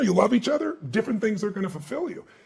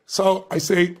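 ordinary talk, nothing marked

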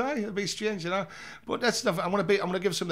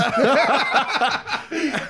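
high laugh at own story; loud laugh; 11 semitones higher, 9 dB louder than usual. Real, not acted.

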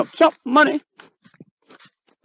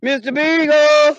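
calling out; 5 semitones higher, slower than usual. Real, not acted. Calling out for lost dog.

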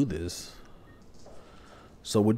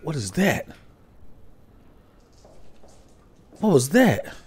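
startled; 6 semitones higher than usual. Real, not acted. Strange noise in dark game.

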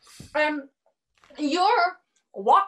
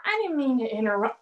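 normal talking voice

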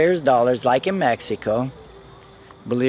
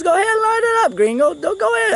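very high, falsetto-like put-on voice; mimicked cop; faster than usual. Cop offering to light his joint, high-pitched mimicry. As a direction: imitate the officer in a squeaky high pitch.

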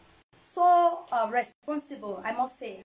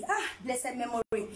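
ordinary talk

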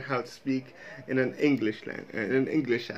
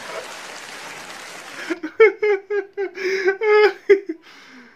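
long, high, loud laughter; real laughing fit; 7 dB louder than usual. Real, not acted. Vlogger reacting to the Cornwall joke, long helpless laughter. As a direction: laugh helplessly till it nearly sounds like crying.

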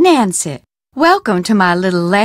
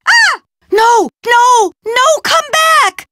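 high, loud cries of no, pleading; desperate shout; 4 dB louder, slower than usual. Acted. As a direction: yell after the thief, panicked and loud. Skull snatched, calling after it.

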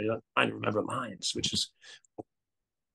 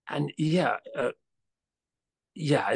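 ordinary talk, nothing marked

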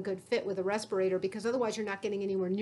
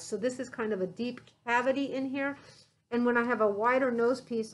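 muffled behind respirator mask; speaking while adjusting mask; through a face mask. Real, not acted.

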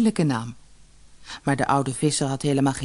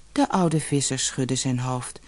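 usual speaking voice

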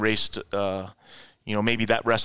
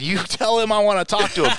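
higher-pitched insistent demand; retold angry demand; 8 semitones higher than usual. Caller to secretary about the boss, pissed but contained. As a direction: imitate yourself pressing a secretary, annoyed. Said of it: "I'm pissed".

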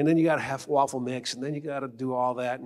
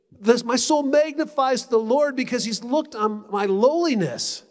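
solemn proclamation; 8 semitones higher, 6 dB louder, slower than usual. Retold or imitated. Quotes Mary's Magnificat.

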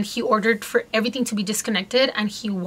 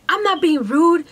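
high, louder indignant denial; raised voice; 7 semitones higher, 5 dB louder than usual. Retold or imitated. Doctor denying rudeness to his patient, raised voice. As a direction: snap back like an offended doctor. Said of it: "he raised his voice at me".

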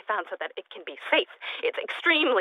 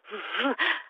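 short, quiet, unhinged giggle; soft giggle from a scientist cracking up; soft odd giggle; 3 semitones higher, 5 dB quieter than usual. Acted. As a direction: giggle to yourself, a little mad. Odd laugh after muttering.

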